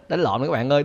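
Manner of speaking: ordinary talk, nothing marked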